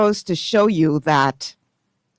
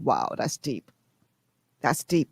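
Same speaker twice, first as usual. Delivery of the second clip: softer inner thought; 5 semitones lower, 8 dB quieter than usual. Retold or imitated. Inner reaction to the quote.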